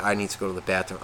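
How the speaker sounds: low, very quiet queasy admission; softly mimicked plea to leave; queasy friend. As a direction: imitate a sick friend, low and under your breath. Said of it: "cringed up, he's just like".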